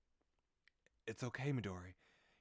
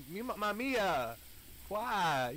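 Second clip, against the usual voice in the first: loud, higher, slow furious yelling; angry outburst; 12 semitones higher, 12 dB louder, much slower than usual. Real, not acted. Streamer furious at Mamiya after the tragedy, yelling. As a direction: yell the name in outrage.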